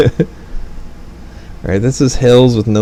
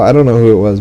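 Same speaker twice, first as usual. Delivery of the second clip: ordinary talk, nothing marked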